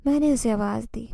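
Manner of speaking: ordinary delivery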